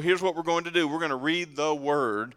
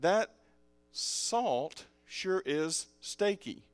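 very slow, "salt" set apart by pauses; deliberate repeat; 3 semitones higher, much slower than usual. Shown as a demonstration. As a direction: spell out "salt sure is steaky" slowly, word by word. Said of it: "let me say it again".

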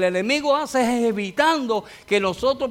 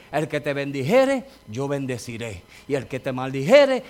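lower, solemn divine pronouncement; voice of God; 6 semitones lower than usual. Retold or imitated. God speaking his promise to his people, low and solemn. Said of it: "Dios dijo".

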